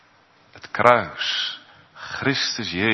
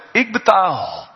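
proclaiming; 4 semitones higher, 4 dB louder than usual. Acted. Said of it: "die laat hij verkondigen".

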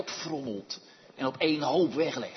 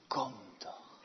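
soft whisper, falling on kom; whispered invitation; 5 semitones lower, 8 dB quieter than usual. Acted. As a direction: beckon gently under the breath. God's beckoning voice.

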